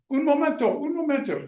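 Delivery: high, loud, urgent "Un momento!"; old monks suddenly calling out to wait; excited Italian exclamation. Retold or imitated. Monks suddenly hurry to help.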